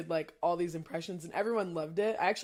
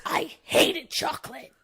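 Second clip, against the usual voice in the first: put-on slow, higher old woman; old lady voice; 8 semitones higher, 3 dB louder, slower than usual. Host voices the old lady, slow. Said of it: "the grandma".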